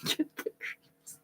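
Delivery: slow, eerie whisper; spooky whisper. Pretend. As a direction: breathe it like a ghost story. Narrator's spooky aside.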